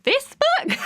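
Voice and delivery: high squeaky disbelief, laughing; incredulous mock. Author mocking her own book, high incredulous laugh.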